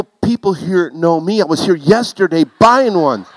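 mock her words in exasperation; husband throwing her excuse back at her; indignant echo. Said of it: "I go".

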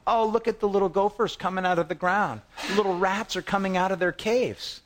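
high, sneering mock; mocking Philistines. Philistines jeering at the Hebrews coming out of caves, high sneer. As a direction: jeer at the little gophers. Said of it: "here the Philistines begin mocking".

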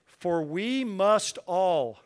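slow, every word weighted, stress on we; emphatic. Acted. Preacher stressing to the congregation: emphatic, slow. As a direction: press each word of "For we must all" home. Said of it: "Notice how emphatic this is".

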